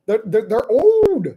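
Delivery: high indignant outrage. Retold or imitated. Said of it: "And I was like".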